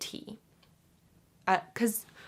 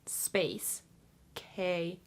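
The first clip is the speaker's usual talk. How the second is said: usual speaking voice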